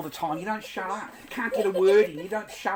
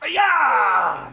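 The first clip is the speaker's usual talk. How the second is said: loud effortful yell; playful shout; 4 semitones higher, 9 dB louder than usual. Real, not acted. Dad swinging his heavy kid, an effortful shout.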